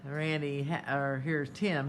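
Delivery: normal talking voice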